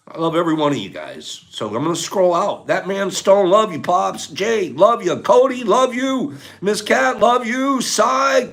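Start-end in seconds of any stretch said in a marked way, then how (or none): 2.6-8.5 high, loud affectionate shout-outs; calling out farewells. Real, not acted. Streamer signing off to viewers by name, calling out. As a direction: holler each name with love.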